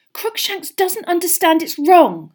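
high, loud, upset defence; voice shaking. A narrator's reading. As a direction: plead with a slight tremble. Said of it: "her voice shaking".

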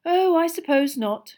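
slow, high-pitched grudging sigh. A narrator's reading. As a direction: give in with a drawn-out sigh. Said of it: "she sighed".

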